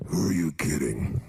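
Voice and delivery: very low, slow voice of doubt; deep, drawn-out put-down; doubting inner voice. Acted. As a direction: drop deep and slow, mocking the big plans. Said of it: "this voice in your se-, head goes".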